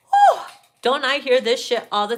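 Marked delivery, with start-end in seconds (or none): 0.1-0.4 high whew. Real, not acted. Reacting to card.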